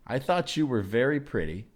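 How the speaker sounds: ordinary talk, nothing marked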